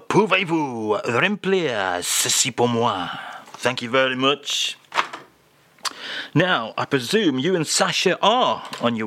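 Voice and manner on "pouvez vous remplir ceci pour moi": lower, slow, hammed-up French crooner; a lightly done [name] with a French lilt, slowly; mock French [name]. [name] reads the French phrase to the host in a mock French accent. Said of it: "with French filling, if you will".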